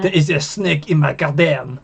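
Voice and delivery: lightly put-on French accent, Arsene Wenger style; a light take on a French football manager's accent. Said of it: "The French accent. I'll just do Arsene Wenger".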